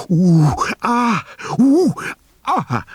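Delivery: high, loud creaky groans of aching joints; rising to your feet with stiff legs, pained groaning. Acted. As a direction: groan like your knees just gave out. Stiff legs after hunkering, groaning.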